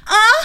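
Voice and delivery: high, loud imitated moan; hammed-up sexual stretching moan; mock moan. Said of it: "you moan really loud".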